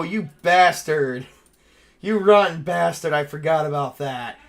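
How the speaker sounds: normal talking voice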